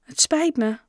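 high, halting little apology; stammering apology. A narrator's reading. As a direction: apologise awkwardly, caught out. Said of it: "stamelde Joe". (beteuterd, hoog stamelend excuus)

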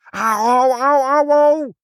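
very high, loud yowling ows; cry of pain. A narrator's reading. Inspector yelps after mother scratches him, high and loud. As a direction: howl in one sustained run of ows. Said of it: "riep meneer Pinkepank".

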